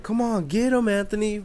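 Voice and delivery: high-pitched mock egging-on; imitated cheering. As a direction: imitate a big brother cheering on a fighter. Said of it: "cheering him on".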